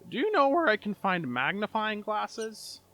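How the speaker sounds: high, slow, lightly voiced old man. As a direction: ask it slowly, like an older gentleman. Older gentleman asks a question.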